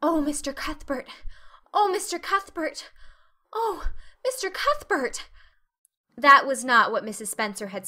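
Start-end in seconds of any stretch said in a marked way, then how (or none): 0.0-5.3 each cry higher and more breathless with wonder; rising rapture. A narrator's reading. Girl calling to Matthew, climbing in rapt wonder. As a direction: build the awe with every repeat. Anne's rapturous repeated exclamation.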